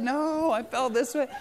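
lightly voiced little boy protesting slowly; imitating fibbing child. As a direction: play a kid sticking to the fib. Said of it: "he's like".

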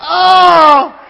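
high loud startled Oh; loud exclamation. Real, not acted. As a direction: burst out dramatically. Dramatic exclamation.

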